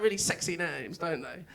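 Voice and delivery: usual speaking voice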